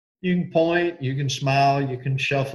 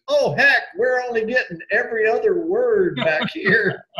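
hollering student; 10 semitones higher than usual. Retold or imitated. Student in the back row teasing the teacher, high and laughing. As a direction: call out from the back of the room. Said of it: "this guy goes".

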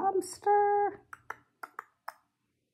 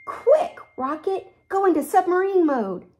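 high, loud, fast urgent order; commanding; 8 semitones higher, 3 dB louder than usual. Acted. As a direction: bark the captain's order. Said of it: "Captain Leo commanded".

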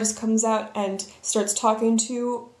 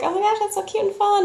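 high cooing delight; gushing fans; 11 semitones higher, faster than usual. Retold or imitated. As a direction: coo it much higher. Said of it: "they're like".

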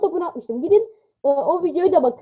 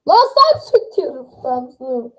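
exasperated; 3 semitones lower, slower than usual. Real, not acted. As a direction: spell it out to a deaf listener. Viewer can't hear, frustrated. (sinirli, yavaş ve tiz)

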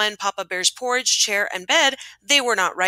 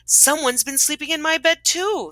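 higher-pitched storybook mother bear; Mama Bear; 7 semitones higher than usual. A narrator's reading. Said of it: "said the Mama Bear".

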